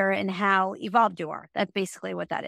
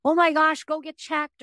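mock panic, pitched much higher; hammed-up frightened blurt of bad news; alarmist warning; 7 semitones higher, 4 dB louder than usual. Reader imagines scaring a client, mock alarmed high cry. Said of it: "I'm not gonna scare someone".

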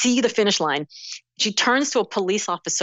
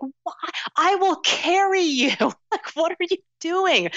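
much higher, pleading disbelief; incredulous; 11 semitones higher, slower than usual. Retold or imitated. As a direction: urge her on, baffled she'd quit. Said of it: "I was like".

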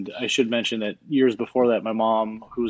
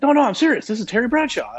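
much higher, loud insistent Terry impression; Terry insisting on the phone, high and loud; Terry Bradshaw voice; 13 semitones higher, 3 dB louder than usual. Retold phone call, Terry voiced.

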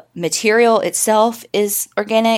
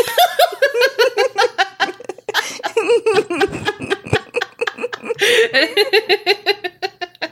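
long high laugh; 12 semitones higher than usual. Real, not acted. Cracks up at her own joke.

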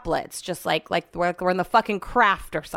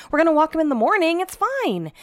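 higher and fast, pushing the point; exasperated insisting; 7 semitones higher than usual. Retold or imitated. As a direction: talk him down impatiently. Arguing with Brett about Benny.

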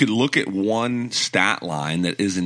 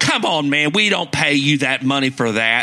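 mock scolding; 7 semitones higher than usual. Mock scolding Westbrook as fans.

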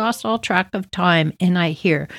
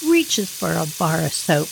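usual speaking voice

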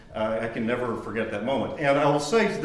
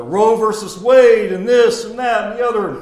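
eager students; 11 semitones higher, 12 dB louder than usual. Retold or imitated. Students guessing answers.

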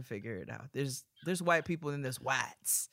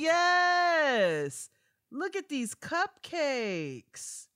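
excited hype; 12 semitones higher, 7 dB louder, much slower than usual. Real, not acted. Hyping listener's cupcake photos.

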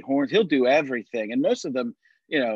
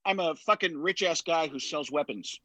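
lightly voiced brash billionaire, laughing; a touch of Robert Downey Junior's Tony Stark; Tony Stark impression; 3 semitones higher, 3 dB quieter than usual. Shown as a demonstration. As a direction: do a cocky rich-guy Stark. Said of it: "hold on, I'll do it".

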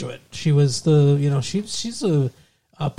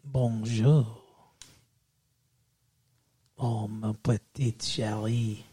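low, slow, soft mock French; French accent; 4 semitones lower, 6 dB quieter, slower than usual. Boyfriend wooing fiancée in a mock French accent. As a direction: ooze it in a put-on French accent. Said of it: "my French accent".

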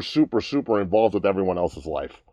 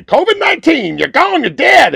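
high booming preacher decree, much louder; mock preacher shout; 10 dB louder than usual. Mocking a preacher who commands covid away, loud and high. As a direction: bellow it like a preacher banishing the virus. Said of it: "he's just gonna say".

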